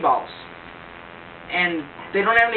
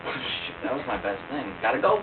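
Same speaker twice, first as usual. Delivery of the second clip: low, soft deflated villain; Voldemort muttering; 8 semitones lower, 6 dB quieter than usual. Retold or imitated. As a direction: grumble it low and quiet. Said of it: "he's like".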